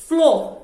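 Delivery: firm, stand-alone "Flo"; isolated syllable. Shown as a demonstration. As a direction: punch out "Flo" as one separate syllable. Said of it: "F L O de um lado".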